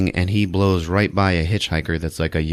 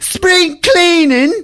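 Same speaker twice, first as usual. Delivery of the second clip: much higher, louder, slow prissy sarcasm; huffy sarcasm; 7 dB louder, slower than usual. Retold or imitated. Mary scolding them for tossing the room, hammed-up huffy sarcasm. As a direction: sneer it like an offended aunt. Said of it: "She's like".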